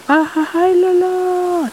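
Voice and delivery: petit rire moqueur plus haut; petit rire du rat. Acted. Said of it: "le rat se met à rire". (le rat ricane sur le dos du poulpe, petit rire aigu)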